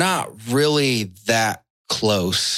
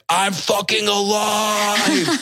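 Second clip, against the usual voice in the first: high, slow, defiant cry; triumphant shout; 11 semitones higher, slower than usual. Pretend. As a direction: shout it to the sky like a Viking. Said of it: "be like".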